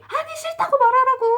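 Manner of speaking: very high, loud mock shout. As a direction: yell in exasperation, imitating a friend. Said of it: "또 성질을 내는 거예요". (성질 내며 새된 고음으로 크게)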